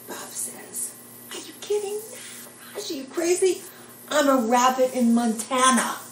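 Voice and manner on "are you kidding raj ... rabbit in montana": high-pitched, giddy disbelief; acted-out excited reply; incredulous delight. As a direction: exclaim it high, building louder to the end. Acting out Bob's incredulous reply.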